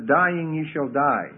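slow, weighty stress on "Dying"; solemn scripture stress; emphatic Hebrew reading. Shown as a demonstration. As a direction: lean hard on "Dying" and draw each word out. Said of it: "more emphatic".

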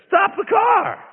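sharp shouted command to stop; mock shout. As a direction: yell at the driver to stop the car. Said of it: "And he said".

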